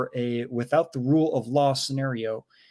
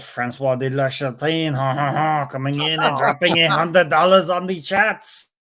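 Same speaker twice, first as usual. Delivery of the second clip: hammed-up bad French accent; badly faked French accent, building louder; very poor French accent; 5 dB louder than usual. Host hails a big donor on the chat, bad French accent. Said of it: "very piss poor French accent".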